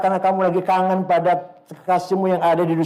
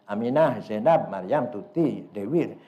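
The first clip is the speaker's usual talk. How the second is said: much softer, lower, bashful listing of names; shy trembling naming; 9 semitones lower, 6 dB quieter than usual. Acted. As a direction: say the names shyly, nearly under your breath. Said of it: "dia gemeter". (menyebut nama dengan malu-malu dan pelan)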